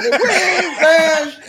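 very high, drawn-out mimicry; high, stretched, laughing impression; movie line imitation. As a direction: quote it slowly through laughter.

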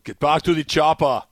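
lightly voiced Schwarzenegger shout; Arnold impression. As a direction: bark it like Arnold. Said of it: "Predator is one of my favorite".

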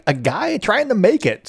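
usual speaking voice

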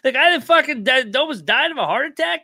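high, loud, incredulous outrage; outraged disbelief. Real, not acted. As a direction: rant like you can't believe the call. Outraged rant about fake punt.

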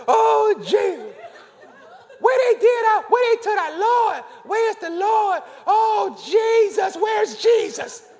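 high, loud mock wailing for Jesus; hammed-up sobbing. Shown as a demonstration. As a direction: wail and shout like Mary at the empty tomb, hammed up. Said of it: "That was perfect".

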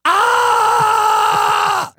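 loud high angry roar; a big man's enraged shout; a loud, high-pitched angry yell; biker's roar. Retold or imitated. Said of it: "he's like".

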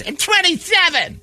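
very high indignant mock squawk; hammed-up high-pitched outrage; mock offended woman. Retold offended woman correcting his guess.

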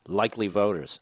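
usual speaking voice

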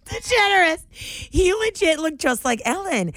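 high laughing confirmation. Real, not acted. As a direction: laugh the name out loud.

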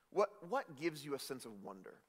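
ordinary delivery

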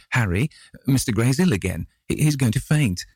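high, urgent cry of concern; alarmed. A narrator's reading. Duchess warns Harry that Dorian is ill, alarmed. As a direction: raise the pitch in alarm, tripping on a word.

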